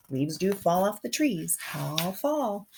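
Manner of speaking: ordinary delivery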